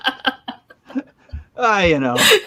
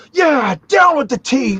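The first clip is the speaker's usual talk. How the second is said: mock rally shout; 11 semitones higher, 4 dB louder than usual. Convert shouts the slogan.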